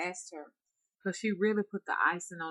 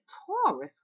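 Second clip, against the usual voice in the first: astonished; 5 dB louder than usual. Retold or imitated. Teller astonished.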